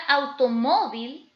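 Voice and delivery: "automóvil" pronounced firmly with force on "mó"; stress on penultimate syllable. Shown as a demonstration. Teacher presenting a four-syllable grave word, stress on penultimate syllable. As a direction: lean into the "mó" of "automóvil". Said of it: "recae en la penúltima sílaba".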